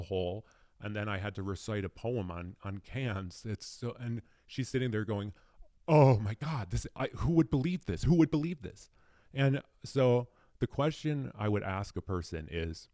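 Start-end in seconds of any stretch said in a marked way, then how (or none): 5.9-8.9 loud, higher, astonished outcry; hammed-up shocked exclamation; disbelieving exclaim. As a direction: imitate a friend losing it in disbelief. Mary reacting in disbelief.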